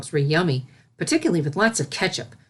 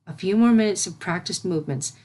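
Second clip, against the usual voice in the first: ordinary delivery, nothing marked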